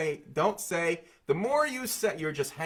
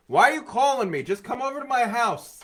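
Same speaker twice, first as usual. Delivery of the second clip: high, loud, exasperated complaint; mock outrage, pitched way up; indignant old-timer; 10 semitones higher, 6 dB louder than usual. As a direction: protest loudly as an old-fashioned grumbler. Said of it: "sixty years ago, people would be like".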